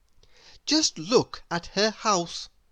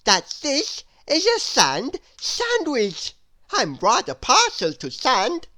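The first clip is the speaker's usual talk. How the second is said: higher, louder silly gentleman; a pompous nonsense man explaining; Mister Nonsense; 8 semitones higher, 5 dB louder than usual. Acted. Mister Nonsense answers Miss Curious grandly. Character voice.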